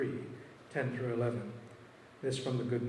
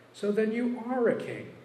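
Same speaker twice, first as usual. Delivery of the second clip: much higher and louder challenge; pressing exclamation; 10 semitones higher, 7 dB louder than usual. Acted. Pilate rejoins to Jesus, high pointed challenge. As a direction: fire it back, high and pointed.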